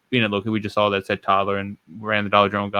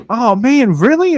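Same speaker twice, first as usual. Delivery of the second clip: very high, louder rising disbelief; mock surprise; 13 semitones higher, 6 dB louder, much slower than usual. Brother acts out the reaction sister didn't have, mock-astonished. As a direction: put on an exaggerated astonished squeal.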